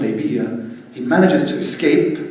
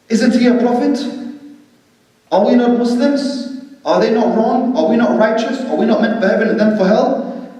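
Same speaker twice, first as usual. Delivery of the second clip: indignant Omar; 9 semitones higher than usual. Retold or imitated. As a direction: fire each question like mock outrage. Omar's indignant questions to Abu Bakr.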